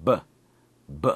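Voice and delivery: B said alone with lips pressed, a burst of air; B sound. Shown as a demonstration. Teacher demonstrating the B sound for Korean learners. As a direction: pop out a clean B, B. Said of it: "Today, it's the B sound".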